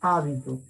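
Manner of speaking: Hábito, weight on the opening A; stress on first syllable. Shown as a demonstration. Teacher repeating the esdrújula, stress on HÁ in Hábito. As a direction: lean on the start of Hábito. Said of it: "la tilde en la A".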